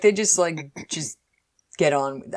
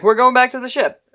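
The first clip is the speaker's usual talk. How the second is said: stern guard order; 8 semitones higher, 6 dB louder than usual. Retold or imitated. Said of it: "He's like".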